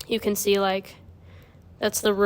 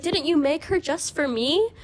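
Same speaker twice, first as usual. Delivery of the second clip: put-on high little British girl voice; 7 semitones higher than usual. Girl asks her father if the toy was made for her, high British child voice. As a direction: ask it like a small British child. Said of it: "the little girl was British".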